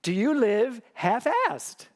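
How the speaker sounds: slow: How much of your life do you live half-assed?; slow, weighty question. Real, not acted. Preacher asking the congregation a pointed question, slowly. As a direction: let each word land slowly. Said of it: "I have a question for you".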